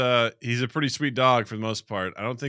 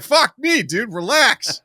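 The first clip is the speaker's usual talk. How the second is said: much higher, louder blurt, then a slow relax; startled yell; 12 semitones higher, 8 dB louder, much slower than usual. Retold or imitated. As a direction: jump at the noise, then drawl out relax. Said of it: "you're like".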